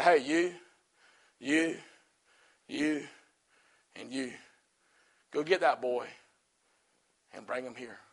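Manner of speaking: low, hushed, with long pauses; quiet command. Acted. Jesus sending bystanders to fetch the blind man, quiet low command.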